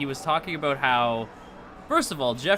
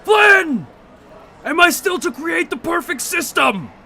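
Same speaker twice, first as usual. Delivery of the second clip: much higher, loud booming villain call; Tron villain shout; 10 dB louder than usual. Acted. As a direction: bellow it like a digital tyrant. Quotes Tron villain Clu.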